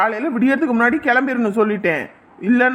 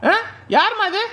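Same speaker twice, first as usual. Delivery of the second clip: higher, raised call to wake her; 8 semitones higher than usual. Acted. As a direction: call across the temple. Priest calls to sleeper.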